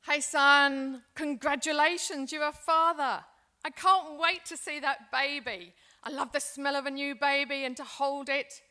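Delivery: thrilled new grandparent on the phone; excited grandparent. Acted. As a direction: burst with delight at the news. Grandparent calls son, new baby.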